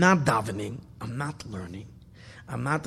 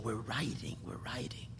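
soft reverent whisper; 5 semitones lower, 14 dB quieter than usual. Real, not acted. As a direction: repeat it in a fading whisper. Repeating phrase then fading.

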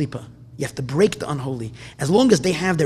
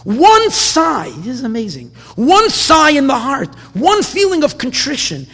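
louder, higher, impassioned sermon voice; fiery preaching; 6 semitones higher, 6 dB louder than usual. Acted. Rabbi stirring his congregation toward repentance, preaching at full force. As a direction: preach it hard, hammering each phrase home.

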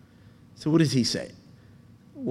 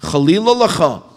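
a louder, sharp, brazen "Chalila lacha"; harsh rebuke; 4 semitones higher, 7 dB louder than usual. Retold or imitated. Avram speaking brazenly to God, a harsh rebuke. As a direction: scold like a bold challenge. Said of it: "even in a harsher way".

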